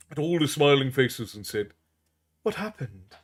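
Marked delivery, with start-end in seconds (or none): 2.4-3.2 softer, drowsy wondering; a woman just waking up confused; quiet bewildered question; dazed princess. A narrator's reading.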